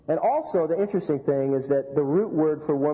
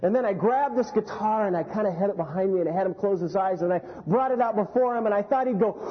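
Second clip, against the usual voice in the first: ordinary talk, nothing marked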